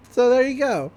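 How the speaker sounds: ordinary talk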